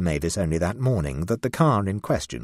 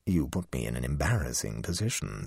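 cool low cat voice; 5 semitones lower, 6 dB quieter than usual. A narrator's reading.

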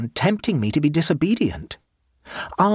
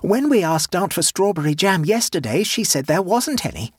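gleeful accusation; 6 semitones higher than usual. A narrator's reading. Boy accusing the aunt of lying, gleefully. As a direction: gloat as he exposes her.

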